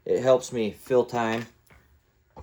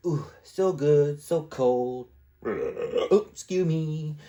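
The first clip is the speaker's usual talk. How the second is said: drawn-out savoring ooh, pitched up a bit; playful savoring voice; 5 semitones higher, much slower than usual. Retold or imitated. Talking to himself at the fridge, savoring a drink playfully. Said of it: "you're like".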